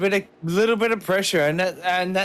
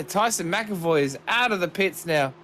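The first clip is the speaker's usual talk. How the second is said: normal talking voice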